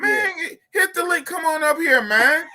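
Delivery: high, pushed call to come up; excited calling out. Real, not acted. Host calling the rapper up to the stream, excited high call. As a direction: hype him up to join the stream.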